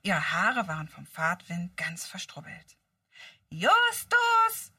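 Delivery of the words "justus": loud high call. Acted. Bob calls Justus across the junkyard, loud and high. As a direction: shout his name across the yard. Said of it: "rief Bob".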